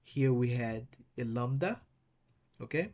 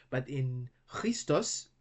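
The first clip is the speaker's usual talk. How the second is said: ordinary talk, nothing marked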